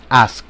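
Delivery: "ask" ending in a released, hollow K; hollow final K. Shown as a demonstration. Teacher shows the K at word's end, "ask" with released K. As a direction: let the K ring briefly after "ask". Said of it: "tenemos que dejar el destello de ese acento hueco que tiene la K".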